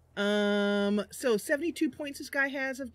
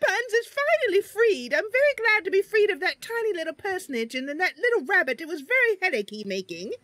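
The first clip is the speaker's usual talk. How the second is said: higher and louder, grandly relieved; Mister Buns gloating over his freedom, higher and louder; freed creature voice; 9 semitones higher, 5 dB louder than usual. Acted. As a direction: proclaim it like a beast finally unleashed. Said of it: "he keeps his original voice".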